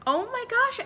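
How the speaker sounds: shocked. Retold or imitated. Finding the trashed basement.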